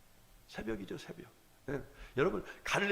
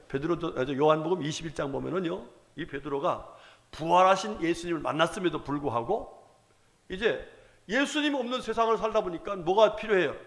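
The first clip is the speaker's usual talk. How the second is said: usual speaking voice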